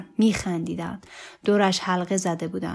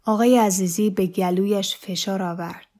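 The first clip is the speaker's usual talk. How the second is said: ordinary delivery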